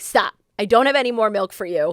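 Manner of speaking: loud mock scolding of a toddler; firm refusal. Mother telling her son she's weaning him, loud firm refusal. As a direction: put your foot down, loudly. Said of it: "I've lately been like".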